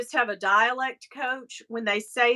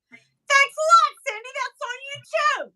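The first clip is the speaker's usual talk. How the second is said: mock blame; 14 semitones higher, 3 dB louder than usual. Mother jokingly blaming Cyndi for daughter's eczema, high sarcastic voice. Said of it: "No, I'm kidding".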